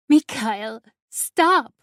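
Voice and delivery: high loud cry, rising on stop; desperate shout. A narrator's reading. Valeska screams at Mikhail to stop, loud and high. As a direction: scream him off her.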